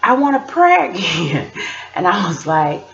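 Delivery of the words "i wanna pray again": put-on high-pitched kid voice; high little-kid request; imitated pleading child. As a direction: imitate a son begging mom in a high voice. Mother voices her young son asking.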